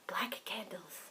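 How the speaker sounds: spooky joking whisper. Pretend. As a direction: whisper it like a secret ritual. Joking about the devil.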